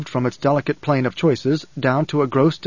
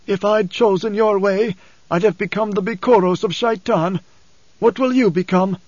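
put-on higher-pitched, strained older father's voice; high-pitched, wounded character voice; Paul, embittered father; 6 semitones higher than usual. A narrator's reading. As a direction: voice an anguished father accusing his son. Narrator voices Paul challenging Leto.

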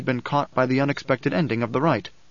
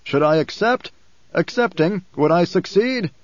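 projected, urgent questioning; shouting; 5 semitones higher, 4 dB louder than usual. A narrator's reading. Man asks Alia whether to kill, shouting. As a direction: call it out to the oracle. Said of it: "a voice shouted from off to the right".

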